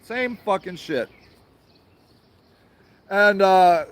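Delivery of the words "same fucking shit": usual speaking voice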